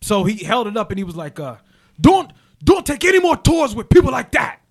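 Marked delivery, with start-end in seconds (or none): 2.0-4.6 high, loud shout of warning. Retold or imitated. Man warns him off tours with people like that, shouting. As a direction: imitate someone yelling a warning. Retelling a stern warning on tour.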